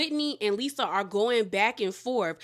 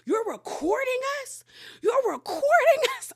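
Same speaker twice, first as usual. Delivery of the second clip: high-pitched incredulous mock screech; very high, scandalized retelling; imitated outrage; 12 semitones higher than usual. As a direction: imitate a friend squealing in disbelief. Retold outraged confrontation by [name].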